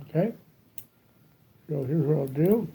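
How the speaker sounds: mumbling around a cigar; cigar in mouth. Real, not acted. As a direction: talk with a cigar between the lips. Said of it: "I'm mumbling with my cigar in my mouth".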